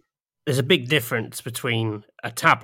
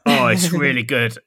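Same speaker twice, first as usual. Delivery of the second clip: ordinary delivery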